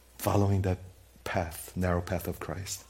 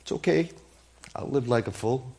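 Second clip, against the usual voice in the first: normal talking voice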